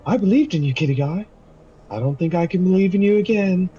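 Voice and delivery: high-pitched, disappointed character voice; Umlaut. Acted. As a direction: put on a high voice of hurt disillusion. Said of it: "Umlaut just shakes his head".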